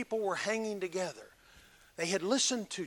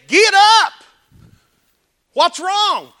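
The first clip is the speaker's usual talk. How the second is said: high, very loud wake-up call; loud rousing shout; 12 semitones higher, 21 dB louder, slower than usual. Pretend. Someone rousing a dull service, shouting high. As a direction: jolt a sleepy service awake. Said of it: "that somebody would just say".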